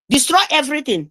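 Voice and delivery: ordinary talk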